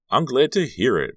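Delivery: loud, high, exaggerated mock gladness; sarcastic. A narrator's reading. Margot answering praise of a stranger, sarcastic. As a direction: pretend delight with a sarcastic lilt. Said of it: "she was being sarcastic".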